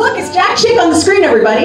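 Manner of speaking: high-pitched show-and-tell call; mock announcement. Panelist calls the audience to the screen, mock announcement. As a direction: call everyone's attention, pitched well up.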